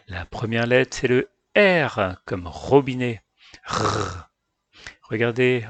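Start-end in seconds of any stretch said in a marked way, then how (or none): 3.6-4.3 R as a rolled-out throat rrr; letter sound R. Shown as a demonstration. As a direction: hold R as a sound, not "erre". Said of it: "qui se prononce R". (R prononcé « rrr », tenu)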